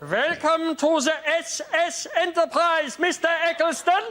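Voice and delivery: very high, slow, drawn-out greeting; sing-song the welcome aboard in a high, strange voice; a high, slow ship's-computer style welcome; put-on welcome voice.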